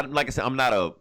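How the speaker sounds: ordinary talk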